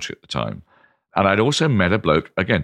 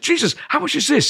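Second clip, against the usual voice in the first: astonished disbelief. Retold or imitated. Telling the Aussie winemaker, high astonished disbelief. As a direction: pitch leaps much higher in shock. Stunned by the cheap wine.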